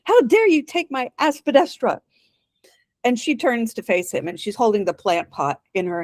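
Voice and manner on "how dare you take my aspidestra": high-pitched indignant call; theatrical, half-restrained shout; mock shout. A narrator's reading. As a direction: call out in outrage, pitched well up without full volume. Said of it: "then he looks up and he shouts".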